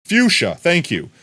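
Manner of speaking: high, loud gloating; vindicated, crowing it; excited I-told-you-so; triumphant. Real, not acted. Triumphant reaction.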